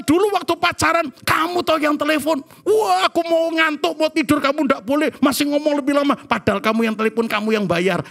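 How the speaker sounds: high-pitched arguing, easing down toward the end; imitated unyielding wife. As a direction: refuse to give in, playacting the wife. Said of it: "dia masih nggak mau ngalah".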